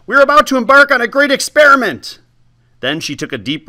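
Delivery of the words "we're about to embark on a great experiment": high, loud squeak. Acted. Old woman announcing the experiment, high squeak. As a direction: squeak it through the pain. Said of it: "she squeaked".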